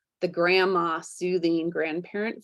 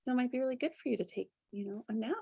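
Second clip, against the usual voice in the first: higher, much softer, sweet suggestion; soft coaxing voice; 5 semitones higher, 9 dB quieter, much faster than usual. Retold or imitated. Gentle inner voice urging a nap, higher and much softer. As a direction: coax gently as if to a tired child. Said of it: "that voice is kinda like".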